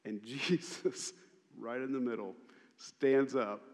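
usual speaking voice

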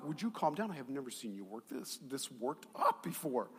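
hushed attempt to calm someone down; calming mother. Mom telling her worked-up son to calm down, hushed reproof. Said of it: "my mom's like".

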